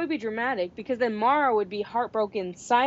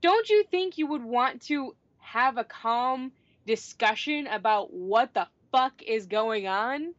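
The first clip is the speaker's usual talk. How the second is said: ordinary delivery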